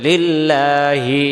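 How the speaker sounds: লিল্লাহি chanted slowly, the la held; slow stretch. Shown as a demonstration. Teacher repeating লিল্লাহি slowly for the class. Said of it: "মানে ধীরে করতে হবে".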